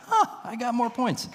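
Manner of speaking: high-pitched mock surprise; surprised realization. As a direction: act out a kid's sudden happy discovery. Boy learns he is ahead.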